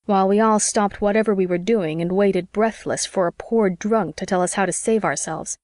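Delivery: usual speaking voice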